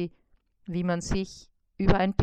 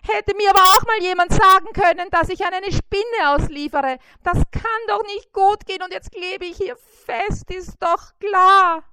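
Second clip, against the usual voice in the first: much higher, louder, fast fretting voice; startled delivery beetle; 12 semitones higher, 10 dB louder than usual. A narrator's reading. Pizza beetle caught in the web, high fast panic. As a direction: gabble it out in a stuck panic. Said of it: "erschrockene Stimme des Pizzaboten".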